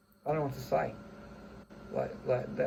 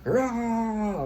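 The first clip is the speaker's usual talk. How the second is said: high, loud mock roar of rage; a cartoonish roar of anger; showing the kind of anger he does not mean; 9 semitones higher, 6 dB louder than usual. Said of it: "It's not like".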